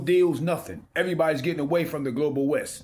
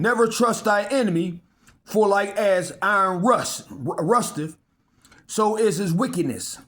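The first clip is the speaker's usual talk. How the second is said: scripture reading; 4 semitones higher than usual. Acted. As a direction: proclaim the verse like holy writ. Preacher reads scripture aloud.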